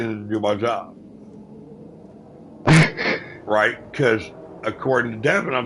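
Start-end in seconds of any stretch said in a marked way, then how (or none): 2.4-3.4 real laugh. Real, not acted. Laughs at his own plan.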